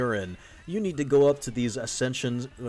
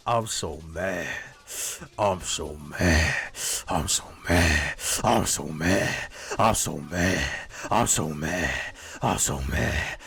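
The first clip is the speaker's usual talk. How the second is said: hammed-up low angry loop; mock anger; 7 semitones lower than usual. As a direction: repeat it like a stuck, low growly chant. Comic chant of feigned drugged anger.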